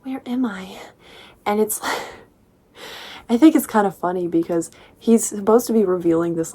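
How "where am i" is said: whispered wonder; hushed mock awe; mock awed whisper. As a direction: breathe it like lost in a dream.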